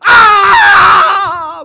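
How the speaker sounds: drawn-out scream, very loud and high; long anguished scream. Retold or imitated. Boy losing control at grandma, screaming long and loud. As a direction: let it tear out long and slow.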